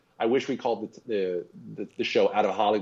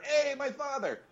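much higher, quick mock Brooklyn aside; Brooklyn accent; 3 dB quieter, slower than usual. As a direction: toss it off in a hammed-up Brooklyn voice. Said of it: "with the Brooklyn accent".

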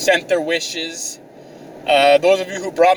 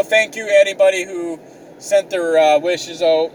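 ordinary delivery

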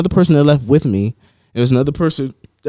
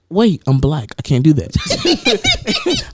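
laughing mock realization; 5 semitones higher, much slower than usual. Laughing punchline.

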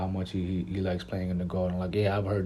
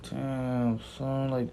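drawn-out, higher-pitched mock dismay; Zion, devastated; 5 semitones higher, much slower than usual. Young player seeing the Knicks get third pick, mock devastated. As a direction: lightly imitate a crushed reaction, stretching the words. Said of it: "he looked devastated".

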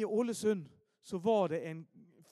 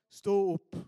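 louder commanding "stå opp"; 7 dB louder than usual. Retold or imitated. Friend commands the corpse at the funeral, loud and commanding. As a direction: order the dead to rise.

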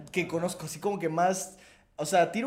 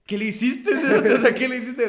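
higher, louder pressing demand; urgent question; 4 semitones higher, 7 dB louder than usual. Retold or imitated. Narrator replays asking his friend, louder and higher. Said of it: "le pregunté".

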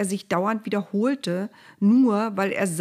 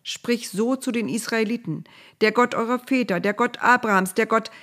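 higher, louder and quicker recital; lightly voiced God hurrying through the list; God repeating himself; 6 semitones higher, 4 dB louder than usual. A narrator's reading. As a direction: rattle off the titles until cut off. God repeats himself until interrupted.

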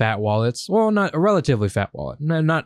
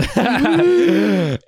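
loud high-pitched laugh; a laugh at his own exaggerated breath; 13 semitones higher, 4 dB louder than usual. Real, not acted. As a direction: burst out laughing. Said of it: "do you hear that breath".

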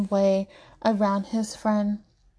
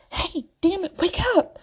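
a much higher urgent yell; 9 semitones higher than usual. A narrator's reading. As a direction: shout him awake, fed up. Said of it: "Chase yells for the fifth time".

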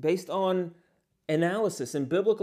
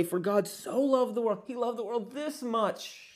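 higher, sweeping recital with arms spread wide; mock preacher, arms spread; 7 semitones higher than usual. Preacher mocking how believers recite with arms out. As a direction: ham up the familiar reading, stretching this much. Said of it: "We, we say".

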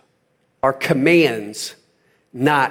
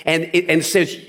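normal talking voice

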